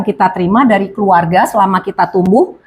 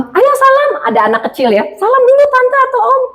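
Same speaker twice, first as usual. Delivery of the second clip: high coaxing parent voice; 14 semitones higher than usual. Retold or imitated. A parent urges the child to greet the adults, high and coaxing. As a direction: sing it up high to coax a child.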